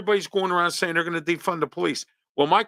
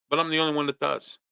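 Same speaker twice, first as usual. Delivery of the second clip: ordinary talk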